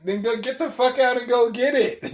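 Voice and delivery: high, loud order to get out; retold angry order. Driver ordering passenger out of the car, high and loud. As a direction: re-enact throwing a passenger out, loudly.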